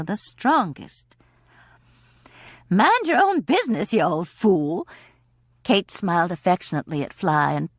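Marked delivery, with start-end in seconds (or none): 2.7-4.9 playful scolding. Acted. Kate scolds Fly playfully.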